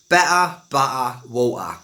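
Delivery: extreme Cockney, T's swallowed; Cockney glottal stops, word by word; Cockney accent. Shown as a demonstration. Teacher repeats the words for learners, extreme Cockney. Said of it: "with a Cockney accent".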